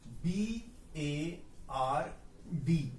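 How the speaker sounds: बी ए आर डी spelled very slowly; slow spelling. Shown as a demonstration. Teacher spelling बी ए आर डी slowly for students. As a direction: draw out each letter. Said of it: "वो कौन सा word है?".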